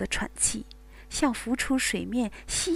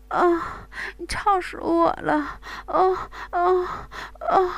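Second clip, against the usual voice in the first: building moans; 7 semitones higher, slower than usual. Acted. Bai Wei near climax, building moans. Woman character moaning to climax.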